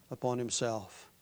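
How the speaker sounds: ordinary talk, nothing marked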